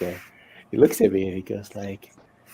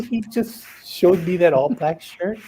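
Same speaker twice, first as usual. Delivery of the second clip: high, louder incredulous questioning; mock indignation; 7 semitones higher, 4 dB louder than usual. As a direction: act out being comically affronted. Retold indignant question, laughing.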